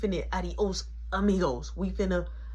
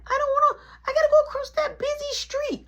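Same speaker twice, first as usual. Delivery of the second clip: put-on very high, loud child wail; squealing mock-child protest; whining kid; 7 dB louder than usual. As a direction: imitate a scared kid refusing to cross. Kid whines about crossing street.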